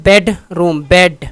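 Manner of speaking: Bedroom slow, बेड hit loud; stress on first syllable. Shown as a demonstration. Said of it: "तो बेड पे".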